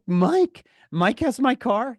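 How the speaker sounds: high incredulous cry; can't believe what you hear; outraged disbelief. Acted. Teen learns parents lent car to Mike.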